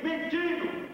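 ordinary talk

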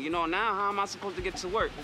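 ordinary talk

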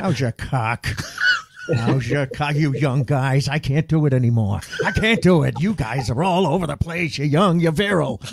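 a lightly voiced old comic teasing; mimicking a veteran host greeting young comics; imitating Kevin Knox. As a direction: rib the young guys like a veteran.

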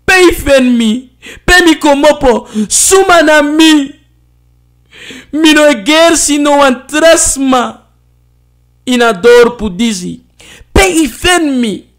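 hoog, luid, door tranen heen geschreeuwd; huilend schreeuwen. Acted. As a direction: schreeuw het uit tussen de snikken. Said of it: "Tussen de tranen schreeuwde ze". (Mofina schreeuwt huilend terug naar Ma)

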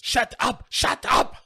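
very high-pitched mock squeal; frantic put-on protest; panicked villain. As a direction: cry out to silence him, hammed-up. Lithgow panicking on radio, acted.

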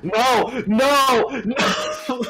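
high, climbing desperate yells; screaming no. Real, not acted. Game failure scream.

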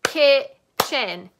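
Kitchen in two loud clapped beats, kitch-en. Shown as a demonstration. Teacher claps kitchen out loud with the class. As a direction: hit both syllables of Kitchen. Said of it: "let's clap it out".

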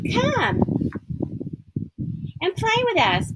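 high playful call to a friend; high sing-song calling. Acted. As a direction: call out brightly, voice way up. Fish calling to play.

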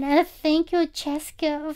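usual speaking voice

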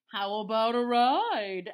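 loud cow voice; a pushy cow asking; lightly voiced bossy cow. A narrator's reading.

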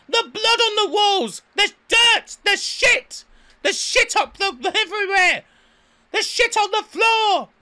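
very high, loud mock outrage; imitated shouting. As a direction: screech like an irate guest berating reception. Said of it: "shouting at the poor receptionist".

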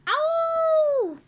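high, loud, long howl; big play-acted yowl. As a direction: let out a long wailing "ow". Acted cry in the story.

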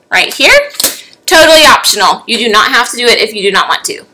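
ordinary talk, nothing marked

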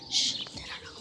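hushed whisper, sneaking around; secret whisper. Real, not acted. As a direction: whisper so nobody hears you. Secretly hiding, shushing the camera.

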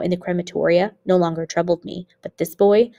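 usual speaking voice

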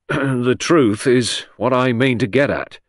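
slow, grim and measured. A narrator's reading. Inspector grimly answering the beaming lady, slow. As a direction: weigh each word, unamused. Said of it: "said Grange rather grimly".

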